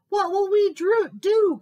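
high, loud alarmed moose; urgent loud question; calling out. A narrator's reading. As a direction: call it out in a panic. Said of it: "called Moose".

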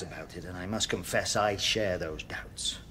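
low, soft near-whisper; hushed confession. Acted. Chaplain confiding doubts to prisoner, hushed confession. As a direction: confide it under your breath.

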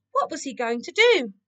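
ordinary delivery, nothing marked

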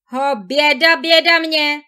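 loud wailing cry of despair; anguished lament. A narrator's reading. Valentín learning his wife ran off, loud anguished wail. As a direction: cry out in heartbreak. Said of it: "vzdychl si Valentín".

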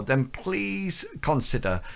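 usual speaking voice

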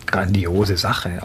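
normal talking voice

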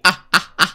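short, evenly spaced bursts of laughter. Real, not acted. Host laughs after "ahora sí", short staccato laugh. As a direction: laugh in a few separate "ah" beats.

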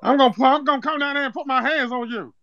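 a lightly put-on accent, prank caller opening the complaint; Zeke from Power. Prank caller tells the home cook about old food, lightly put-on accent. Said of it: "why is he talking like Zeke off power".

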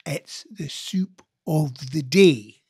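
put-on very slow Glasgow accent, deliberately spelt out; Glasgow waitress. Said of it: "And that's Glasgow, Edinburgh for you".

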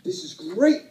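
wife back from the party, all fired up and gushing; excited wife. Retold or imitated. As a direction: gush like she's thrilled. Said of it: "She's like".

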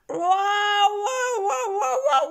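high, loud wolf wail falling; falling wolf cry. Acted. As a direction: cry out while falling down a well. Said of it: "Il tombe dans le puits et crie".

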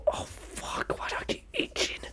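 annoyed whisper to himself; whispered aside. Real, not acted. Muttering to himself about itching, whispered. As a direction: whisper it under your breath.

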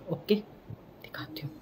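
slow, whispered aside; hushed slow whisper while hiding the camera; secret whisper. Real, not acted. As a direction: whisper it so nobody hears.

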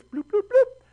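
high, silly bloop noises; time-skip bloops. Pretend. Teacher skipping years in the story with high silly bloops. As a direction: chirp a fast-forward sound.

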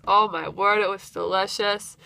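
ordinary delivery

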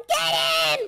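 high-pitched attack yell; high, excited command; urging shout. Acted. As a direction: yell it to egg someone on. Urges brother to attack.

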